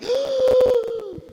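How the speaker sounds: sharp high gasp, as if startled by a spider; mock gasp of fright. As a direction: suck in air like you just felt a spider. Fright at spider placed on hand. (jadeo agudo de susto fingido)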